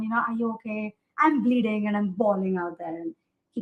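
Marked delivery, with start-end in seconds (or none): none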